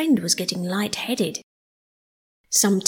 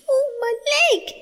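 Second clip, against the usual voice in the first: very high squealing mole voice, drawn out; pained mole cry; 4 dB louder, slower than usual. A narrator's reading. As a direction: cry out in pain as the hurt mole. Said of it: "he cried".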